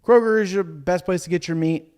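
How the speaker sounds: loud, high announcer pitch; ad read. Acted. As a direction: sell it like a radio ad. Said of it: "I'm reading an ad now".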